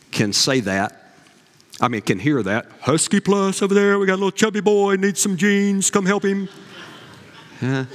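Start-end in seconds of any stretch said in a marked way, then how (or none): 2.8-6.5 a hammed-up salesman paging over the intercom; mock store intercom. Sears clerk paging for the chubby boy's jeans, hammed-up and higher.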